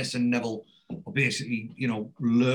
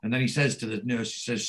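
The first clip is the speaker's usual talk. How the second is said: usual speaking voice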